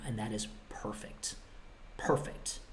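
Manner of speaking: breathy whispered "perfect". Real, not acted. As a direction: drop to a whisper to make it intimate.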